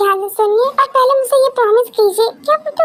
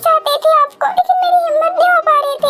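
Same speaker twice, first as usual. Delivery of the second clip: put-on tearful girlfriend, voice raised higher, begging for a reply; tearful pleading; 6 semitones higher than usual. Girlfriend begs silent [name] to forgive her, tearful and pleading. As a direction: plead through tears for him to speak. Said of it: "Stop it, रोना बंद करो।".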